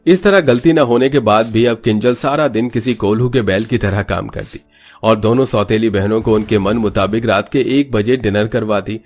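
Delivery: plain reading voice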